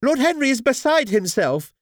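high, agitated mother's voice; shrill with agitation. Acted. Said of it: "her voice shrill with agitation".

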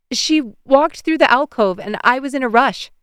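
flustered defence. A narrator's reading. Embarrassed mother justifying herself to neighbor, flustered. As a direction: blurt it out defensively.

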